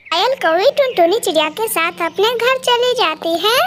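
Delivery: usual speaking voice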